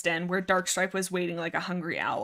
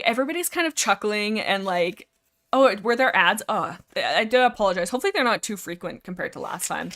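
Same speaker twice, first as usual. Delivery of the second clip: usual speaking voice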